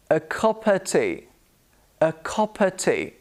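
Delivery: "of" reduced to "uh", v dropped; careful model of "cuppa tea"; weak "uh" for "of"; schwa in OF. Shown as a demonstration. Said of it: "The of becomes a".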